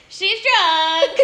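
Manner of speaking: high, loud, drawn-out mimicry with laughter; hammed-up high holler; imitated catchphrase. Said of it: "I'm like".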